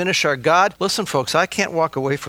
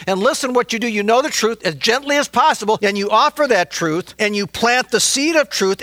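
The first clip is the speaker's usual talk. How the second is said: raised, pressing pulpit voice; exhorting preaching; 7 semitones higher, 3 dB louder than usual. Acted. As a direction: build the call to action. Exhorting congregation to offer truth.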